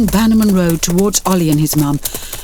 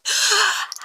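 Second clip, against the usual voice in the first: high, soft gasp of amazement; a sharp little intake of breath; boy gasping at his webcam; excited gasp; 13 semitones higher, 5 dB quieter than usual. A narrator's reading. Boy sees strangers on webcam.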